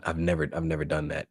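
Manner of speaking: low, soft, halting mock confession; imitated sheepish admission. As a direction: mimic a caught-out brother, quietly. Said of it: "He said".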